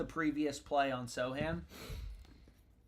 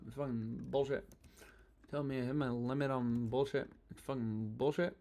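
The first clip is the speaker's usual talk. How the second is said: disgusted grumbling; 2 semitones lower, 4 dB quieter than usual. Real, not acted. Streamer ranting at the app over his limit, grumbling. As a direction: grumble it at the screen.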